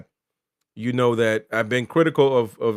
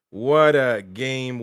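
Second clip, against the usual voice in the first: normal talking voice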